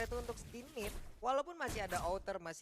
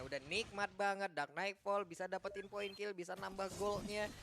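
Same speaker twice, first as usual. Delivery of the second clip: ordinary talk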